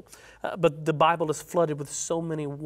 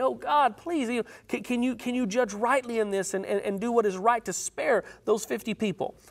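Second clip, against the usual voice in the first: higher, urgent begging to God; a voiced Abraham, pleading higher; 6 semitones higher than usual. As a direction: plead with God as if the city hangs on it. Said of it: "So he's pleading with the Lord".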